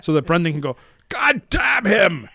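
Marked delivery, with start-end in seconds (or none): 1.1-2.3 loud, slow mock Irish shout; Irish accent. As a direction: yell it in a hammy Irish brogue. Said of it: "That was your Irish accent?".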